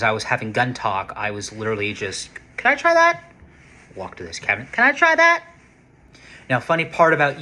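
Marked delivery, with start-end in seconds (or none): 2.6-3.2 eager high ask. Retold or imitated. Asking the clerk to try a gun, eager high voice. 4.7-5.4 eager high ask. Retold or imitated. Asking the clerk to try a gun, eager high voice.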